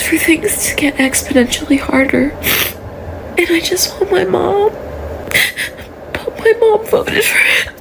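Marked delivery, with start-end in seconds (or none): 3.4-7.7 higher, cracking into tears; voice breaking. Real, not acted. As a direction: say it as it hurts.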